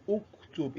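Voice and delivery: "Uktub" with an initial u sound; correct dhamma pronunciation. Shown as a demonstration. Teacher gives students the correct imperative, dhamma pronunciation. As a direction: open "Uktub" on u, as the model form. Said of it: "you pronounce it with what?".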